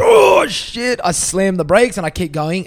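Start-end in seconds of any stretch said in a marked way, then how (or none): 0.0-1.0 sudden high yelled curse; retold shout. Driver spotting highway patrol, sudden yell. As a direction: yell it as you spot the cops. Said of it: "And I'm like".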